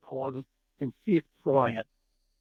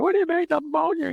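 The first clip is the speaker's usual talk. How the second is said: retold protest, pitched way up; imitating an indignant friend; high-pitched mock retort; 14 semitones higher than usual. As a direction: throw it back in a high, incredulous voice. Acting out old friend's retort.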